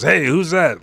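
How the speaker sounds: high, loud questioning call; acted challenge. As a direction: call out the stranger. Sanji challenges a newcomer.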